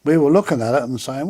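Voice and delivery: ordinary delivery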